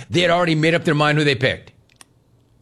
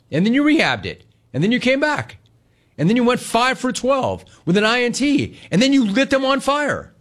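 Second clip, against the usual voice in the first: building rant; 9 semitones higher than usual. Real, not acted. Escalating rant about the player.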